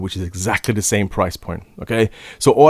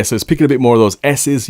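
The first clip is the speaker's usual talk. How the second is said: ordinary talk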